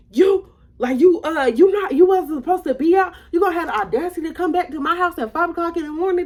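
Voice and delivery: high, loud, indignant scolding; worked-up mom rant, pitched high; mama spazzing. Retold or imitated. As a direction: imitate a mother going off on her kid. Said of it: "my mama is spazzing".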